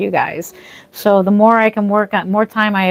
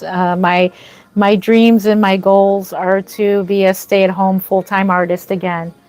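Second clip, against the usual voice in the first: ordinary talk, nothing marked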